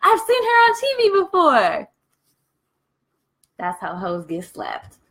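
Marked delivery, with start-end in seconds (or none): 0.0-1.9 high, mocking bystander voice; gossiping onlookers. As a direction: pitch it up and gawk like nosy neighbours. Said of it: "they would have been like".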